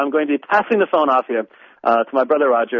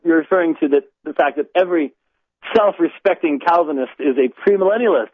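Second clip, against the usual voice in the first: usual speaking voice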